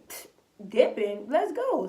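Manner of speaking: loud, eager Let's go with a scoff first; hyped agreement. Retold or imitated. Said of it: "I'm like".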